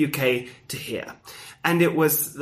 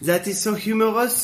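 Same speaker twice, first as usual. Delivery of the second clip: stiff European; 5 semitones higher than usual. Retold or imitated. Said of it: "the Europeans elsewhere just going like".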